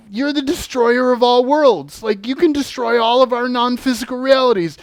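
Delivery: high, loud, fast at first; accusing shout about destroying worlds; heated accusation. Retold or imitated. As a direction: accuse them with urgency.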